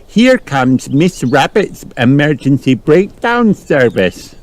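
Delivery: put-on high little-girl announcement; storybook child's amazed report; gasping excitement. A narrator's reading. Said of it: "gasped Peppa".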